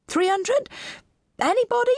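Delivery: very high, questioning auctioneer; auctioneer's appeal. Acted. Auctioneer to a silent room, high appeal. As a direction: appeal high for any bid.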